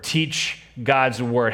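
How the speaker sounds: slow, stressed teach God's word with weight on "God's"; slow emphatic. Shown as a demonstration. Pastor to congregation, spelling out the action, slowly.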